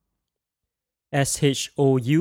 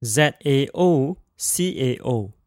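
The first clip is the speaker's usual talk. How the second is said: ordinary talk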